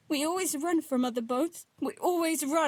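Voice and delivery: high, loud, desperate pleading; cling to the rule, terrified; panicked plea. Acted. Girl pleads, rising panic.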